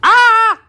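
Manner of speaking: short startled shriek, high and loud; sudden high yelp. Real, not acted. Startled streamer lets out a sharp yelp. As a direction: yelp as if something made her jump. Sudden cry while filming.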